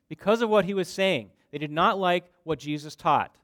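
loud, high, forceful sermon delivery; impassioned preaching. Real, not acted. Preacher telling how disciples withdrew, impassioned preaching.

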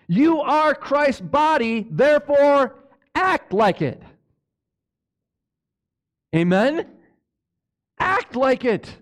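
full-throated sermon climax; preaching at full force. Acted. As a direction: drive the point home to the congregation.

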